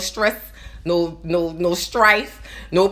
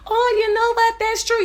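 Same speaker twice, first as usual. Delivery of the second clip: mock sudden realization; 13 semitones higher than usual. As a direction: play the listener suddenly agreeing, over the top. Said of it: "You're like".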